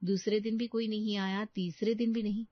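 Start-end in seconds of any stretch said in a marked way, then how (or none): none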